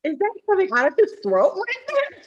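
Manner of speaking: high, shocked what-the; stunned disbelief. Retold or imitated. Classmate turning round in class, high shocked disbelief. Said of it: "I just remember turning around like".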